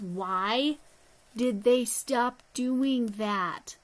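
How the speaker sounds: loud, drawn-out mock complaint; exasperated lament. Crafter to viewers over discontinued arrows, exasperated lament.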